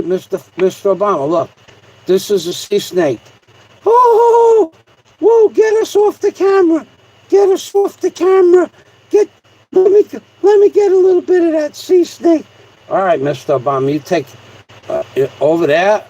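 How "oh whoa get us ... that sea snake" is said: very high, loud squeaky panic; panicked puppet. Pretend. Puppet Mister Obama sees a sea snake, squeaky panicked high cries. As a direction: shriek it like a tiny scared puppet.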